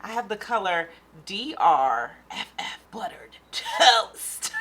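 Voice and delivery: slow teasing whisper of the color name; playful whisper. Pretend. Vlogger whispering the wig color to viewers like a secret. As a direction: hush it like a secret reveal. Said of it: "I just be cracking myself up".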